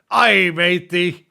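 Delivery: slow, loud hammed-up pirate growl. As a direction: deliver the punchline like a stage pirate. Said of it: "Scarlet Cap Anonymous saying".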